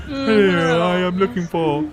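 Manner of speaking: high, loud butler voice; puffed-up butler. Acted. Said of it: "Butler voice".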